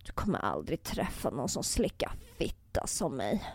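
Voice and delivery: low, hushed voice imitating his whisper; imitated whisper in the ear. Woman retells what he whispered in her ear, hushed imitation. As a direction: drop low and soft, as if murmuring into an ear. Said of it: "Då viskade han i mitt öra".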